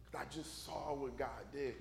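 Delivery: low, quiet reasoning; hushed, confiding appeal; servant gently advising. Acted. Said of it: "His servant wanna say".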